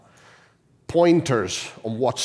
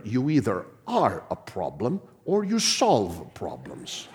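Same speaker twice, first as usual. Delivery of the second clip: ordinary talk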